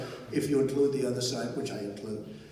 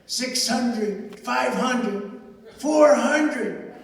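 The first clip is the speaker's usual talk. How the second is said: building countdown; 8 semitones higher, 8 dB louder, much slower than usual. Retold or imitated. Mock altitude countdown building.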